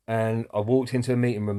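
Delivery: normal talking voice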